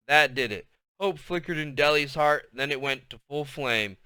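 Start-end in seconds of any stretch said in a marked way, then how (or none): none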